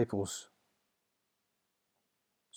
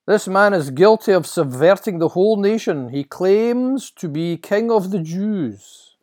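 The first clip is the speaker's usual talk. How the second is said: loud, high public accusation; accusing priest; 10 semitones higher, 11 dB louder than usual. Acted. Temple authorities presenting him to Pilate, accusing. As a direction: denounce the prisoner to the governor.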